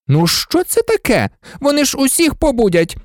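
high-pitched fussing; indignant alarm. A narrator's reading. Nurse worried they will wake everyone, indignant. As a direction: protest the noise in agitation.

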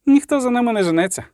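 high, quick objection; voiced poet. A narrator's reading. Poet objecting to the rush, high and quick. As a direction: protest quickly in the poet's high voice.

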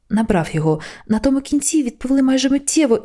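plain reading voice

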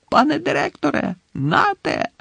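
very high cry, not full volume; strained yell. A narrator's reading. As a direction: cry out desperately to the headmaster. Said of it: "загорлав Гаррі".

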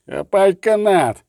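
loud, slow roared command; roaring. Acted. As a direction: bellow it across the water. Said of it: "ричав Ольсен".

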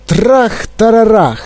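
high, slow storybook crash; emphatic onomatopoeic bang; crash imitation. A narrator's reading. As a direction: draw out the smashing word dramatically. Narrator imitates the sudden crash.

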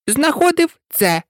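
high, testy mouse reply; rather irritated. A narrator's reading. Mouse answering the interrupting duck, rather irritated. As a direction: repeat it with mild irritation, pitched high. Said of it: "досить роздратовано відповіла миша".

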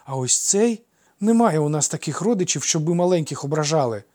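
put-on high, loud boy brooking no argument; peremptory. A narrator's reading. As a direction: lay down the law in a child's voice. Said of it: "безапеляційно закінчив за брата Ефікл". (безапеляційно, по-дитячому голосно)

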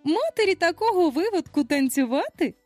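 high, incredulous motherly protest; high-pitched scandalized outcry; exclaimed. A narrator's reading. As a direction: question it in shocked disbelief. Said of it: "вигукнула Венді".

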